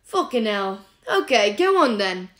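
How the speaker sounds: loud, high, cracking tirade building to exhaustion; furious rant. A narrator's reading. Remus ranting at Sirius about everything, near tears. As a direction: pour it all out in rage.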